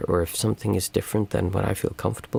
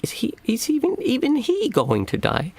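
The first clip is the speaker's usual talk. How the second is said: put-on very high child's voice, slowing down; worried little girl; 3 dB louder than usual. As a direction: ask it like a small child who can't believe it. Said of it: "And she's like".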